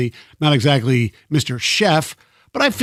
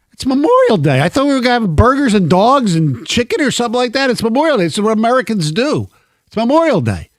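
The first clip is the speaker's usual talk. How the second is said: higher and louder, insisting it's Memorial Day; exasperated protest; 7 semitones higher, 4 dB louder, faster than usual. Retold or imitated. To his wife, refusing Uptown Parthenon: exasperated retold protest. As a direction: hammer the point home, mock outrage.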